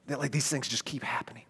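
weary whispered aside; hushed tired whisper. Real, not acted. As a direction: confide it under your breath. Confiding weariness to listeners.

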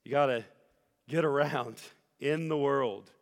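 usual speaking voice

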